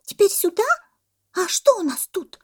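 high, loud squeaky monkey question; puzzled little monkey piping up high; surprised monkey. A narrator's reading. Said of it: "удивилась мартышка". (удивлённая мартышка)

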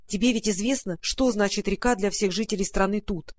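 usual speaking voice

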